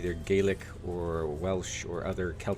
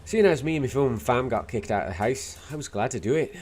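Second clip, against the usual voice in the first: put-on Irish accent with a Scottish lilt; attempted Dublin accent. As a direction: do a not-very-good Dubliner. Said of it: "Scottish lilt out of the attempt at an Irish accent".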